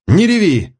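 sharp high yell. A narrator's reading. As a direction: bark at her to stop crying. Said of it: "заорал Мисарж". (резкий высокий окрик)

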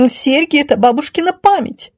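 high, adamant lady; high-pitched, non-negotiable refusal; categorical objection. Acted. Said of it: "категорично возразила красавица".

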